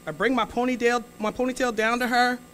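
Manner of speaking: usual speaking voice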